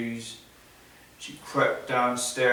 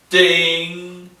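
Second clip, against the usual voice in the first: ding sound; 6 semitones higher, 8 dB louder than usual. Acted. Sound of the magic ring.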